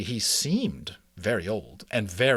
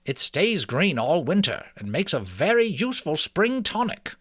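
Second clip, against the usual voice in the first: put-on higher, louder learned old mouse; an old herbalist proudly naming his plant; scholarly old mouse; 7 semitones higher, 4 dB louder than usual. Mister Ages explains herbs.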